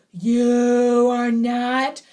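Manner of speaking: loud, slow growl in a possessed voice; deep growl. A narrator's reading. Possessed bride to old Benya at the wedding, growling. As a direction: growl it out slowly and forcefully. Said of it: "she growled at old Benya".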